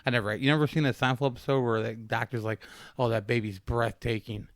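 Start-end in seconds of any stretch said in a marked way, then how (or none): none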